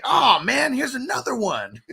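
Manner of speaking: high giddy character voice; high excited quote. Retold or imitated. Retelling a movie scene to cohost, high giddy voice. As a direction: gush it like he found a treasure. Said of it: "And he's like".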